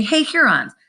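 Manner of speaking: high-pitched hail, lightly acted; calling out. French trader hailing the Hurons, calling out. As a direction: call for their attention, pitch up.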